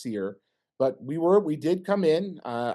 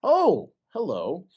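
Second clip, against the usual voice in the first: startled greeting; 6 semitones higher, 4 dB louder, much slower than usual. Retold or imitated. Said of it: "and you go".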